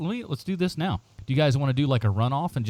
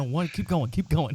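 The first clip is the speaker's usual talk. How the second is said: ordinary talk, nothing marked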